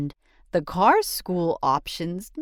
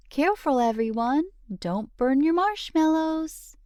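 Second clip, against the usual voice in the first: put-on a high, bright call to the kids round the fire; high caring warning; 9 semitones higher, faster than usual. Parent warning the kids at the campfire, high and caring. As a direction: warn the children gently but high and clear.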